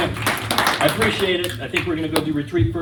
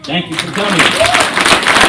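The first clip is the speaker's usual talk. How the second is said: loud, slow closing thanks; loud sign-off; 3 semitones higher, 10 dB louder, much slower than usual. Real, not acted. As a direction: call it out big to the whole room. Calls out the speech's closing thanks.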